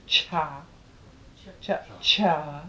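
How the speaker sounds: Cha, cha with a breathy burst on each ch; aspirated cha. Shown as a demonstration. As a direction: push air through every Cha. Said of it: "With a real breath in it".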